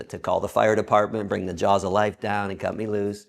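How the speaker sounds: ordinary talk, nothing marked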